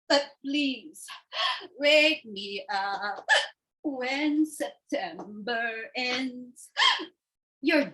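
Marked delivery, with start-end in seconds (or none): none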